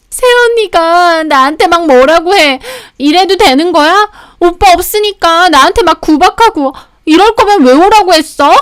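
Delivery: high, loud whining complaint; tearful tattling. Acted. Sister-in-law running to her brother about the wife, tearfully whining. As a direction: wail to big brother like a wronged little sister. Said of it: "남편 오자마자 막 울면서".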